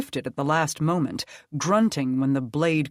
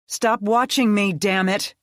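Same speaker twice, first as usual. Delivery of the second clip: loud, higher, sharp angry snap; snapping angrily; 6 semitones higher, 6 dB louder than usual. A narrator's reading. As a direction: bite his head off for staring. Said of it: "Krista snapped".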